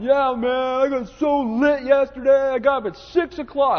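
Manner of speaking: slow, swaggering party-boy brag in a put-on voice; boastful gym guy. Preacher imitating a gym guy bragging about getting lit, boastful drawl. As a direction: drawl it out like a proud hungover guy. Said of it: "this guy was over there going".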